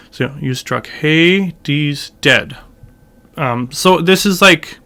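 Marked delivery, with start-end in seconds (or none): 0.4-2.6 very slow, "Hades" and "dead" emphasized; stress on Hades and dead. Shown as a demonstration. Teacher picking out emphases, slowly stressing "Hades" and "dead". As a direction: stretch out "Hades", then land on "dead". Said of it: "this first emphasis is sort of".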